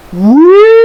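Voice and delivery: high, loud rising whistle cry; long piercing imitation of an engine whistle; ghostly whistle. A narrator's reading. Percy spooks Thomas, ghostly high whistle. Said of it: "Percy gave his ghostly whistle".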